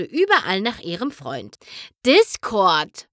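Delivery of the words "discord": louder, falling groan of his name; fed-up sigh of a name; exasperated groan. A narrator's reading. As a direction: drag out the name in weary exasperation. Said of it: "stöhnte Twilight".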